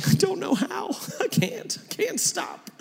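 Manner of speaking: put-on high, soft whimpering child voice; crying six-year-old. Dad imitating his crying six-year-old daughter, mock tearful. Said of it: "my six-year-old and she's crying".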